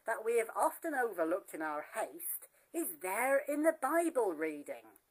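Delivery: usual speaking voice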